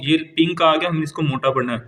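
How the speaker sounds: ordinary talk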